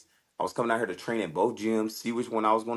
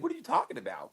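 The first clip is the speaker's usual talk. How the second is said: high, softer baffled question; baffled challenge; 13 semitones higher, 6 dB quieter, faster than usual. Retold or imitated. Fighter questioning his coach, high baffled challenge. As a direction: ask it bewildered.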